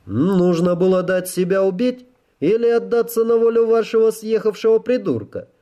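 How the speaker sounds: high-pitched, indignant officer; agitated officer. Acted. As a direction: throw it back as an incredulous question.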